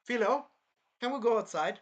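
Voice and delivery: high, loud sing-out to a pet; coaxing Naofumi. Acted. Naofumi calling Philo to go outside, high and coaxing. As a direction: call out high, rising into a question. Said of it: "He would be like".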